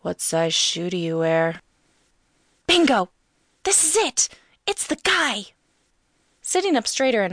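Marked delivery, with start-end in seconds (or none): 0.0-1.6 slow, creepy male-caller voice; slow and unsettling. A narrator's reading. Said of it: "Creepy words". 2.7-5.5 high, triumphant exclamations; excited realization. A narrator's reading. Narrator's triumphant inner exclamation.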